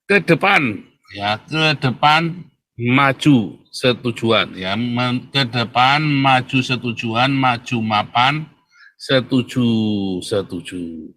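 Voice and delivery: stretched-out, deliberate syllables; drawn-out hotline slogan; slow mnemonic. Shown as a demonstration. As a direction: spell it out slowly so listeners remember the number. Spells out hotline mnemonic slowly.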